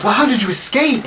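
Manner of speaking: high, curious question; concerned aunt. Acted. Aunt asking her nephew about escape, high eager voice. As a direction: ask in a high character voice.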